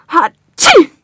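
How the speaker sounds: loud acted sneeze; big theatrical sneeze. Sick patient sneezes at doctor.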